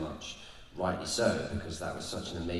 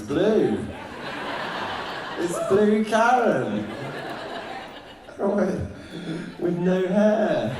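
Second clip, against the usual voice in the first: high, loud, slow laughing delight; 11 semitones higher, 7 dB louder, much slower than usual. Real, not acted. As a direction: squeal it out through laughter. Gleeful joke about Nebula.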